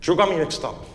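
put-on lightly Cuban-accented gangster; Scarface accent. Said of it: "English with a Spanish accent".

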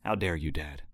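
hushed mock indignation; mock indignant son. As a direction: play the offended son, under your breath. Voicing son's outrage.

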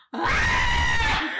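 loud, high shriek of fright; hammed-up terrified scream; mock scream. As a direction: imitate a man screaming at a bug. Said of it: "And Chance goes".

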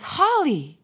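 delighted surprise, calling out a name; excited greeting. Retold or imitated. Friend spotting an old schoolmate across the way, excited greeting.

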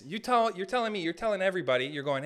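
ordinary talk

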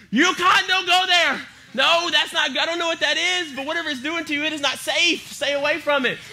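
high-pitched panicked warning cry; frantic dog warning a friend of danger; scared dog. Acted. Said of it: "Remy's scared of everything".

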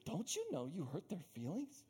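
soft, hushed tut-tutting; mock hushed scolding. Disciples scolding Jesus, soft hushed scolding. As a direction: scold quietly like a fussy friend.

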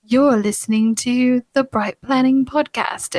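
slow, hammed-up British podcast-intro voice; badly faked British announcer; awful British accent. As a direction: put on a bad British accent, slowly. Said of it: "that's my really awful, uh, British accent".

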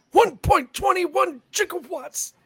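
high, slow shouted exclamation; Doc Brown impression. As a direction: shout it like a frantic mad scientist. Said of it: "Christopher Lloyd".

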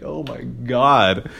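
very slow, stretched disbelief; exasperated drawl. Retold or imitated. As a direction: drag every word out, fed up. Exasperated at locked door.

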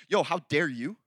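put-on accusing tone; mock indignation. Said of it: "he's not like".

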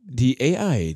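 loud, mock announcer intro. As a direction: announce the AI grandly.

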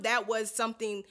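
normal talking voice

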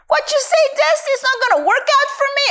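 high, fast mimicked objection; high-pitched, hurried mock complaint; acted protest. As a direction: rattle it off high like a doubter retold. Acts out a doubting exchange.

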